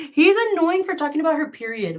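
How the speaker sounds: real amused laughter; laughing. Real, not acted. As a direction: laugh it out while calling him annoying.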